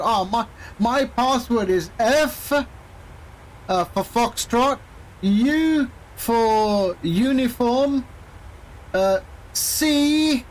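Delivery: slow, drawn-out letters and code words; mock-helpful spelling. Teller stringing along a scammer with a password, mock-helpful. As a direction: spell it out painfully slowly, toying with him. Teasing caller with spelt password.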